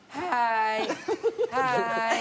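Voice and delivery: lightly voiced cheerful teacher greeting; a hint of a dad coaxing his kid; sing-song greeting to a child. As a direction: greet a small child brightly. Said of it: "Gua ngajarin ya, ya kan gini".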